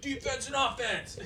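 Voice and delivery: gruff coach impression. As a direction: growl it like a movie coach. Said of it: "he's like".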